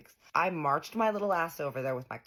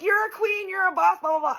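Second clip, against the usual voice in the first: much higher, louder hype; cheering friends; 7 dB louder than usual. Retold or imitated. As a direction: cheer her on like a hype squad. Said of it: "They're like".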